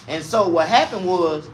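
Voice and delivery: normal talking voice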